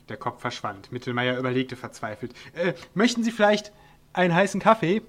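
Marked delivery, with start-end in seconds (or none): 2.5-5.0 higher and louder call down into the hole. A narrator's reading. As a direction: call out hopefully to the dwarf. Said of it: "rief er dann".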